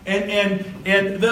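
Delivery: usual speaking voice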